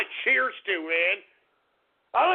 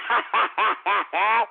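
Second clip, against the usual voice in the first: hearty laugh; 6 semitones lower than usual. Real, not acted. Laughs after drinking.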